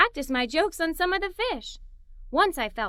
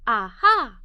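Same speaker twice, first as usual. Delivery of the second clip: a sudden bright idea, louder; excited aha; 4 semitones lower, 6 dB louder than usual. A narrator's reading. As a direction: burst out with the discovery. Idea strikes the narrator.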